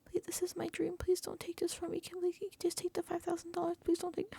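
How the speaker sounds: high, soft imitation of desperate begging; mock pleading. Housemate pleading with Catherine for his dream, mock pleading. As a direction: plead in a hushed, high pity-party voice. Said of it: "doing the little pity party".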